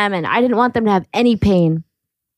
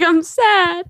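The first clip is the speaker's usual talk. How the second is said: high-pitched, hammed-up sad sister; mock sad; 9 semitones higher than usual. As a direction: imitate a pitiful little sister. Acting sad little sister at camp.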